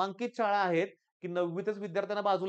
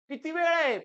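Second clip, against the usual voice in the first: rhetorical exclaim; 9 semitones higher, 6 dB louder than usual. Real, not acted. Teacher's rhetorical question to students.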